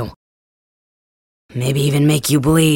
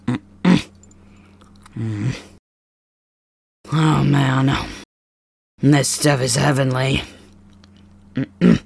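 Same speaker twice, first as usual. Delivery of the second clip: talking while chewing; mouth full; slower than usual. Real, not acted. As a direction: talk through a mouthful of food. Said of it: "don't talk with my mouth full?".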